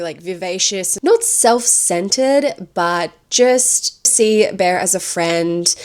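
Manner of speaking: ordinary talk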